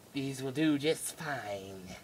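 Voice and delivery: slow, soft villain approval; mock creepy dentist; sinister doctor. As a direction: savour it slowly and quietly.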